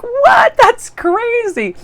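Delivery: very high, loud squealed disbelief, drawn out at the end; acted amazement. Vlogger re-enacting her reply to the compliment, squealed astonishment. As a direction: squeal it as if bowled over.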